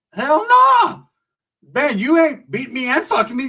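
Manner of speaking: high, loud cry of disbelief; comically outraged, voice leaping up high; retold outraged refusal. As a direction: blurt out an appalled refusal.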